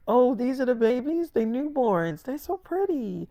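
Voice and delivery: high, sweet admiring coo; cooing over babies. Acted.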